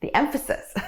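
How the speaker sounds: breaking into a laugh; high, amused, tipping into laughter. Real, not acted. Coach notices her own emphasis, laughing.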